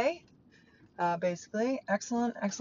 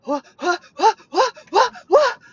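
loud, high staccato mock yelps, climbing; Michael's "wha-wha" yapping; 12 semitones higher, 13 dB louder than usual. Stepdad winding her up, imitated loud yapping. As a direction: fire off repeated yelps, each one punched. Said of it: "he started, like, doing that thing".

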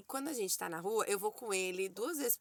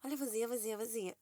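her own inner echo; 7 dB quieter, much faster than usual. Shown as a demonstration. Mother showing the phrase looping in her head, softer. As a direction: repeat it softly like a song stuck in your head. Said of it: "a minha cabeça tá assim, ó".

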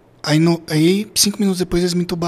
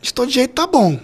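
excited; 2 semitones higher, 11 dB louder than usual. Retold or imitated. Laughing at thinking he'd died.

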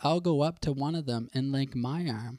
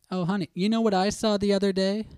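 eager, loud and higher; bursting to tell honey; excited sharing; 6 semitones higher, 5 dB louder than usual. Pretend.

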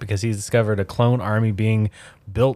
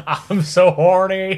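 high, loud, slow hammed-up wail; mock anguished wail; 8 semitones higher, 7 dB louder, slower than usual. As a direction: wail it out in comic melodrama. Acting out Anakin's tantrum.

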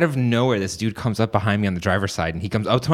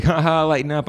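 mock joking; 6 semitones higher than usual. Homeless man mockingly laughs off the gesture.

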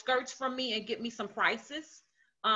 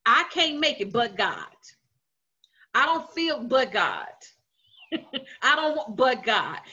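preaching; 3 semitones higher, 6 dB louder than usual. Acted. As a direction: answer every doubt with But God. Preaching refrain.

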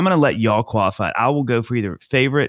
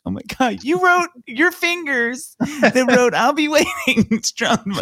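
high, drawn-out falsetto fan squeal; starstruck gushing; slower than usual. Retold or imitated. Intern retelling thanks from his idol, starstruck gushing. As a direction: gush in a squeaky voice, sending up your younger self.

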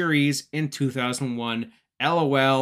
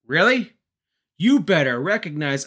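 indignant call; 2 semitones higher, 6 dB louder than usual. Retold or imitated. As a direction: call out like a cocky wrestler. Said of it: "D'Lo Brown pokes his head ... being like".

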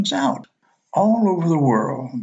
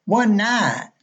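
"One night" blurred to "wunnite", pitched higher; lightly mimicking the clerk's Southern slur; Alabama accent; 7 semitones higher than usual. Clerk repeating herself to a puzzled guest, mild Alabama drawl. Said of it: "Beg your pardon?".

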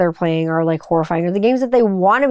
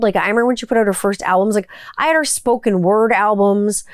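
ordinary talk

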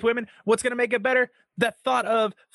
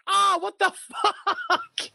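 laughing disbelief; 12 semitones higher, much slower than usual. Retold or imitated. Retold reaction to nut tap.

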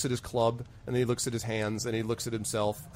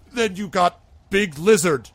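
loud, high, slow troll voice; confounded troll; 7 semitones higher, 8 dB louder, slower than usual. Acted. Troll pointing out the lizard companion, loud and slow. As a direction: heave out each word loudly and slowly. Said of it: "and he looks at you".

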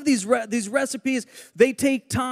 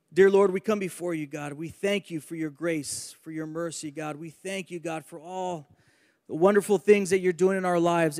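softer, lower-pitched earnest prayer; public prayer; 4 semitones lower, 6 dB quieter than usual. Real, not acted. Preacher praying to Father God for understanding tonight, soft earnest prayer. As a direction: lead the room in prayer, hushed and flowing.

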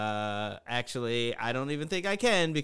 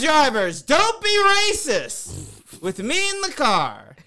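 loud rant; 11 semitones higher, 7 dB louder than usual. Real, not acted. Ranting at Uber drivers.

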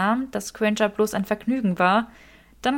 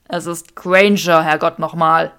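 exasperated Draco; 3 semitones lower, 9 dB louder than usual. Acted. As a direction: hammer out each word, fed up. Draco exasperated, repeating himself.

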